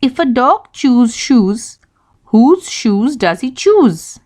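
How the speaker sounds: slowly, each word clear; slow, careful tongue twister. Shown as a demonstration. Teacher reading slowly to young learners. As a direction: read it slow so every sound lands. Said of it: "Here it goes".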